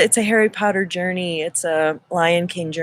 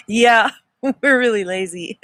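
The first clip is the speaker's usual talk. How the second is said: laughing through the words; 5 semitones higher than usual. Real, not acted. Agreeing with Michael Salla's joke, laughing aloud. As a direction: chuckle while agreeing with the joke. Laughs at lazy population remark.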